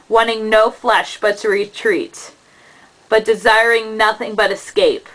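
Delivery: plain reading voice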